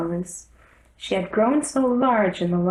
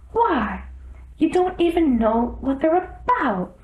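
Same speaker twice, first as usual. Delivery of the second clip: put-on higher, indignant girl; a little girl protesting; young girl voice; 9 semitones higher than usual. Alice protests.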